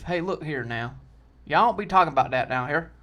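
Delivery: mock Southern local laying down the law; put-on Southern accent. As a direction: warn them off in a Southern accent. Resumes put-on Southern patrolman voice.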